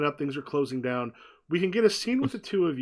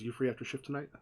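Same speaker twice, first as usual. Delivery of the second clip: hushed invitation; 9 dB quieter, faster than usual. Acted. Emma repeats her quiet question.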